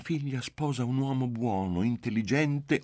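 high, coy mother's voice proudly praising her future son-in-law; coquettish mother.

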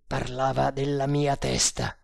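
plain reading voice

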